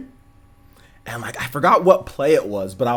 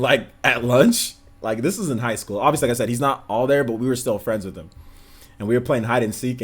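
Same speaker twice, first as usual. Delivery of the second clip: normal talking voice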